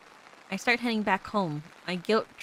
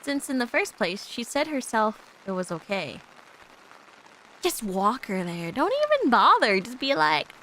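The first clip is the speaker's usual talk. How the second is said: ordinary delivery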